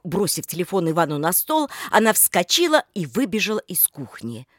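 usual speaking voice

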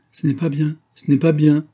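acted, rising disapproval; scolding. A narrator's reading. Old nurse scolds the oath-takers.

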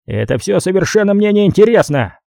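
a touch of irritation, brushing it off; lightly annoyed, dismissive; irritated reply. A narrator's reading. Gang boss on the phone, mildly irritated. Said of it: "раздражённо ответил Харрисон".

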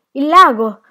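loud, high cry of alarm; breathless alarm. A narrator's reading. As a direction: cry the warning as if winded. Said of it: "disse Tea Cake con voce affannosa". (grido affannato d'allarme)

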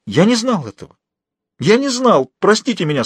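high, pleading voice; distraught apology. Acted. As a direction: plead innocence, pitch leaping up. Shaken prisoner begs forgiveness. (умоляющий высокий голос)

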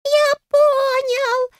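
very high, drawn-out happy squeak; tiny fairy crowing with joy; Gustav, joyful squeak. A narrator's reading. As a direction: squeal it slowly with delight. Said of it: "радостно пропищал Густав".

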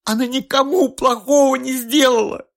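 high, loud weeping protest; sobbing cry. A narrator's reading. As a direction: wail it through sobs. Mother keeps crying out.

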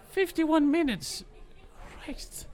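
high, slow disbelief; shocked. Real, not acted. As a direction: stretch it out in disbelief. Shocked at the running time.

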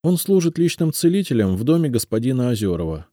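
тонкий девичий голос, с надеждой; Елизавета, робкая девушка. A narrator's reading. Elizaveta continues. (голос повыше, робкая просьба девушки)

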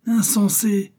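high-pitched outcry of reproach; sudden high exclamation; exclaimed. A narrator's reading. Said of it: "s'écria Milady".

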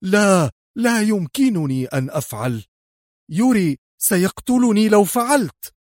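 high-pitched plea in pain and terror; panicked cry. A narrator's reading. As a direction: plead as if terrified for your life. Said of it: "بكل الذعر والألم". (صرخة ذعر وألم)

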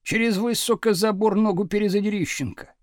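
plain reading voice

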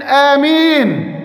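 آمين stretched very slowly and called out; loud amen. Retold or imitated. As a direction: intone آمين slowly and solemnly. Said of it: "قال بصوت عال". (آمين بصوت عالٍ وببطء شديد)

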